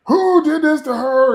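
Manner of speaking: high panicked shout; frantic yell. Retold or imitated. Tim Toole yelling over the hurt woman, frantic shout. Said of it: "And he's like".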